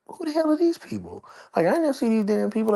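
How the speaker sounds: incredulous squeak at strangers upstairs; surprised. Retold or imitated. Shocked by strangers on balcony.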